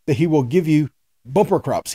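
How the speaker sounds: normal talking voice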